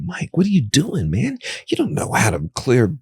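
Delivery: incredulous inner voice mocking himself. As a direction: let the doubting voice in your head laugh at you. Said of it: "this voice is like".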